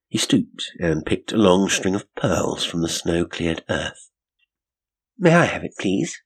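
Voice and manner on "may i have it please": high-pitched polite request. A narrator's reading. Woman asks detective for the pearls, polite. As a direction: ask sweetly, starting high.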